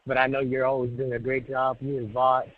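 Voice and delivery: ordinary talk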